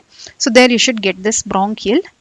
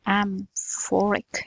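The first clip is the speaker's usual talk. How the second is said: Amphoric stretched out very slowly and quietly; slow drawn-out amphoric; 11 dB quieter, much slower than usual. Shown as a demonstration. Teacher slowly stretches Amphoric to show its ring. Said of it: "That's metallic".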